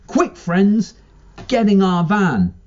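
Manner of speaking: high, loud urgent yell. A narrator's reading. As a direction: shout at them to hurry. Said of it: "one of them yells".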